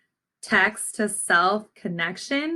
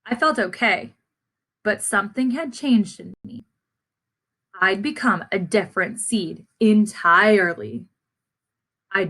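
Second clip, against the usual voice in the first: put-on gentle read-aloud to young listeners; story narration. A narrator's reading. Teacher reading the class a picture book, storytelling voice.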